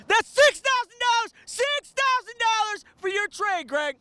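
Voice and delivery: high, loud hype shout of the price. Shown as a demonstration. Dealer shouts the cash offer to viewers, loud and high. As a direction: belt the number like a fight announcer. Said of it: "you gotta say it a little louder".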